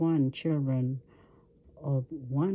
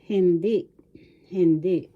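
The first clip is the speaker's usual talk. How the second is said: loud, higher hindi with DI stressed; stress on second syllable; 4 semitones higher, 6 dB louder than usual. Shown as a demonstration. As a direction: say hindi firmly and louder, accent on the last syllable. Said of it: "The accent is in the second syllable".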